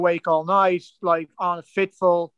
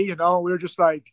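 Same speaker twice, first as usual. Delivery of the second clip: ordinary talk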